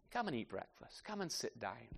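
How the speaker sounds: soft, hushed invitation to sit and eat; gentle invitation. Acted. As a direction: coax them quietly to the table. Voicing Jesus inviting the disciples.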